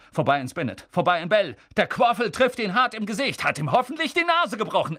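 excitable match commentator. Acted. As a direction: build from brisk calls to heated, high commentary. Commentator's play-by-play builds up.

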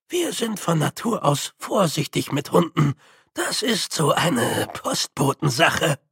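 put-on old hand explaining in character; character voice, slowing toward the end; Grütze, cautious postman.

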